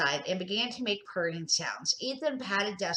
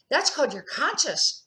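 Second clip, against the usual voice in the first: loud voice; 7 semitones higher, 4 dB louder than usual. A narrator's reading. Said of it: "he said in a loud voice".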